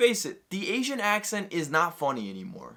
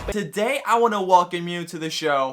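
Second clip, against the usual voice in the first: ordinary talk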